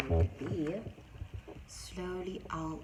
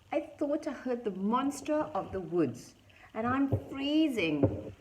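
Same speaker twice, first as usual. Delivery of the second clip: distressed plea; 6 semitones higher, 5 dB louder than usual. A narrator's reading. Mouse pleads from the storm.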